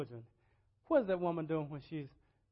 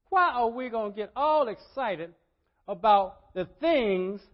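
loud, higher, insistent preacher; preaching; 5 semitones higher, 12 dB louder than usual. Real, not acted. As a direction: hammer the rhetorical point in repeated phrases. Preaches at full force.

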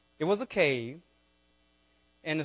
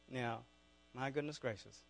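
usual speaking voice

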